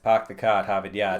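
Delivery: hammed-up Boston accent with dropped r's; mock a thick Boston accent; put-on Boston "pahk the cah"; dramatized Boston accent. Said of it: "wildly dramatized".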